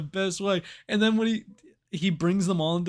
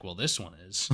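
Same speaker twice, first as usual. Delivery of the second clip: low, softer dry answer; deadpan reply; 8 semitones lower, 6 dB quieter than usual. Retold or imitated. Imitating the farmer about his gun, low and dry. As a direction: say it flat and unbothered. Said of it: "He's like".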